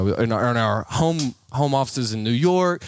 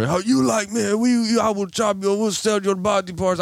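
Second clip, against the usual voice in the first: put-on raised, accented scammer tirade; mock threatening scammer; 9 semitones higher than usual. Scammer threatening to chop him up, high imitated rant. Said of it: "lays into it me more".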